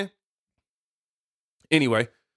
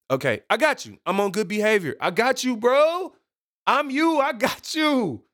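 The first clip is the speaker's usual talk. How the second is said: high-pitched, climbing reassurance; agreeable other self; 9 semitones higher than usual. Pretend. Host reassuring himself in the meeting, high-pitched playful. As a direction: promise good behavior in a rising high voice. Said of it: "I had to have a meeting with myself".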